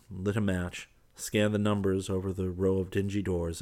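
plain reading voice

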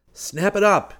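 high, loud hurry-up yell; yelled command. A narrator's reading. Seaton urging the others across the wrecked dock, high yell. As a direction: yell it out to get them moving. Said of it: "he yelled".

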